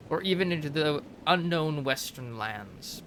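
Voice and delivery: measured storyteller read of a codex entry; lore narration. Acted. Reading a game codex entry to viewers, storyteller narration. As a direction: narrate it like a history tome.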